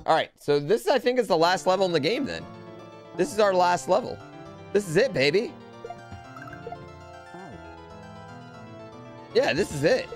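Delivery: high-pitched excitement for the final push; rally everyone for the last round; hyped up. Real, not acted.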